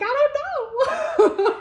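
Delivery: very high, slow laughing whimper; mock helpless whine. Friend imitates partner's clueless answer, laughing. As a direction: whimper it like a lost child. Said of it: "and you're like".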